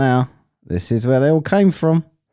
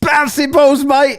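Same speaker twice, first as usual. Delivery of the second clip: much higher, louder mocking echo; mock parody; 14 semitones higher, 4 dB louder, slower than usual. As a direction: parrot his line back in a silly higher voice. Mocking the debater's catchphrase.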